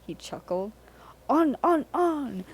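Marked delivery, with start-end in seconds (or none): 1.3-2.4 high, loud gleeful chant; laughing trucks. A narrator's reading. The trucks urge Percy on, high and gleeful. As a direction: egg him on with glee. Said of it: "laughed the trucks".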